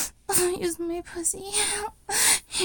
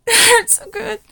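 much louder and higher, crying out; loud cry of pleasure; ecstatic cry; 5 semitones higher, 12 dB louder than usual. Acted. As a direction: let it break out as a cry. Climax in erotic roleplay.

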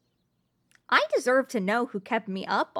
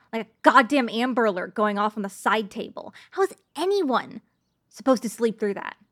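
ordinary talk

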